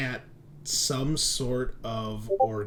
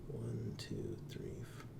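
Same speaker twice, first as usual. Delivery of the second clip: near-silent whispered count; counting under breath; 13 semitones lower, 16 dB quieter, slower than usual. Real, not acted. Game master counting through players, whispered under the breath. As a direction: count quietly to yourself.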